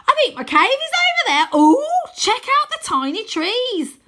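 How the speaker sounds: put-on very high, loud boy voice; cheerful boy. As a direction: chatter happily like a tour guide. Wynne commentary, delighted.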